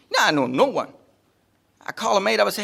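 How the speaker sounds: normal talking voice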